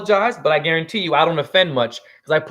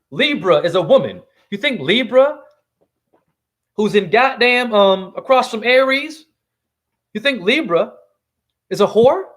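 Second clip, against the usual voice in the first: ordinary delivery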